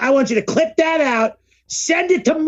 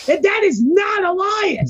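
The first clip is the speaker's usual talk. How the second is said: higher, shouted conspiracy rant; mock outraged rant; 7 semitones higher than usual. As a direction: yell it like a convinced conspiracy nut. Same mock rant continues.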